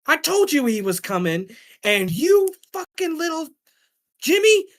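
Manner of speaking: high, loud scolding mom voice; scolding mother. Retold or imitated. Mother scolding her son Jimmy for fighting, high angry rant. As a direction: tear into him like a furious mother.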